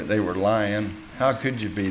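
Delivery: ordinary delivery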